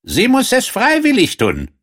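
very high frog character voice; insistent frog. A narrator's reading. Frog sets his condition before the king, high insistent voice.

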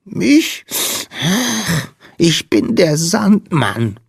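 high, slow, sniffly squeak; piping, tearful little Sandman; sniffling little creature. Acted. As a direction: sniffle between the words in a tiny voice. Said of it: "schniefte der Wicht".

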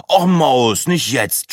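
drawn-out annoyed moan; groaning. A narrator's reading. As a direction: groan a weary plea to a partner. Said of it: "stöhnte Breitling und Sina".

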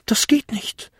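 high-pitched goblin voice; small creature's high protest; little goblin objecting. Acted. Goblin answering in his voice.

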